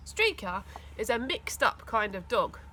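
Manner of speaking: ordinary delivery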